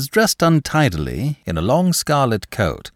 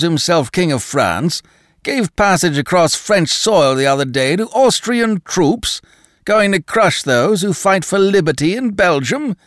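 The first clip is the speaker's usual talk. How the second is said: higher, louder hectoring harangue; hectoring Danton; 7 semitones higher, 3 dB louder than usual. A narrator's reading. Danton berates the Bretons, hectoring loudly. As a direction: lecture him like a bully.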